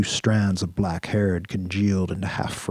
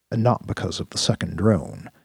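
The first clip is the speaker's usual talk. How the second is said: ordinary delivery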